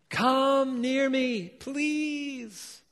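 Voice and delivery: high, slow gentle invitation; tender plea. Acted. Joseph invites his terrified brothers closer, high slow tender plea. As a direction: draw the frightened brothers in slowly. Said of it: "Joseph said to his brothers".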